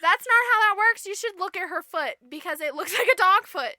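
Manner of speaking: high, loud correction; scolding birds. Retold or imitated. Birds tell prince about the foot, high and loud. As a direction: pipe up loudly, nearly laughing.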